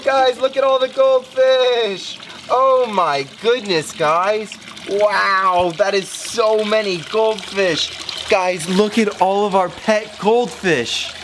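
slow, high, awestruck; amazed. Real, not acted. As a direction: marvel at the sight slowly. Amazed at many goldfish.

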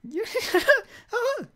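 squeaky falsetto laugh; high cartoon giggle. Landlady voice laughing.

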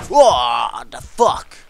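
slow loud what the fuck; stretched shocked shout. Real, not acted. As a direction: let disbelief blow up. Missed in game, shocked.

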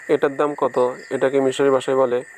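ordinary talk

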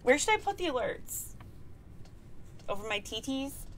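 ordinary delivery

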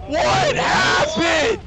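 can't believe he exploded; shocked yelling. Real, not acted. Sudden explosion in game.

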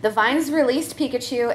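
normal talking voice

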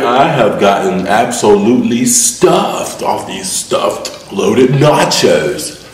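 slow drawn out. Real, not acted. Stuffed, drawn out boast.

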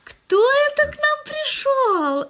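high, slow singsong coo; baby talk to patient. Acted. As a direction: coo at her like at a small child. Сиделка сюсюкает с больной. (сюсюкающий высокий голос сиделки)

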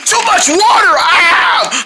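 loud, high alarmed cry; panicked yell. Acted. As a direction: yell as water sprays. Sink squirting water.

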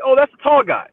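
very high, loud starstruck squeal. Retold or imitated. Co-host voices [name] seeing him in the street, very high and loud. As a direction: shriek it like a dazzled fan spotting him.

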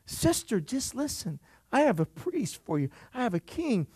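hushed, gentle promise; soft voice of God. Retold or imitated. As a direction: lean in and whisper the promise. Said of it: "he's looking at you going".

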